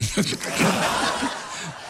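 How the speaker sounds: airy laugh. Real, not acted. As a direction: chuckle under your breath.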